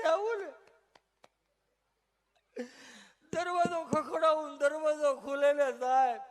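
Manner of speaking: high, loud, building mock lament; hammed-up wronged husband crying out; mock wailing complaint. Patient's rising complaint to doctor.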